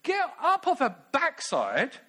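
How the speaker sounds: high, whiny, exasperated plea; Martha complaining. Acted. Martha complains to Jesus about her sister, high whiny plea. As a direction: play Martha nagging Jesus, high and put-upon.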